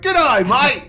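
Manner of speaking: loud, high mock Australian greeting; Australian accent. Son imitates father's accent, loud broad Australian. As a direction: belt it out like a hammed-up Australian. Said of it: "master of accents".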